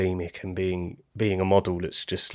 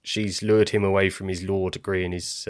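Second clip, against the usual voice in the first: usual speaking voice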